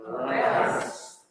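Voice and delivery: whispered slow name; crowd answering. Acted. The crowd to Pilate, slow whispered answer. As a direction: breathe the name out slowly.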